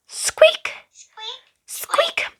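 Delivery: very high squeak; squeaking mouse. A narrator's reading.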